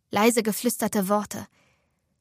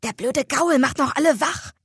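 annoyed cursing, pitched up; verärgertes Fluchen; 4 semitones higher than usual. A narrator's reading. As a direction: grumble crossly at the noise. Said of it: "fluchte eine dunkle Stimme". (ärgerlich schimpfen)

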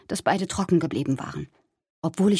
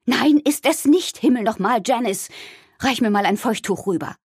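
exasperated mother; 4 semitones higher, 4 dB louder than usual. Acted. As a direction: snap back indignantly, then demand help. Upset mother snaps back, demands wipe. (genervte, aufgebrachte Mutter)

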